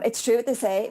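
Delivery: ordinary talk, nothing marked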